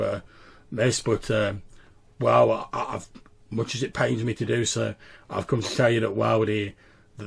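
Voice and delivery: deferential, halting voice with slight gruffness; slightly gruff servant. Acted. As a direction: deliver news hesitantly, a little gruffly.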